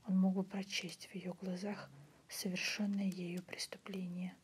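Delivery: usual speaking voice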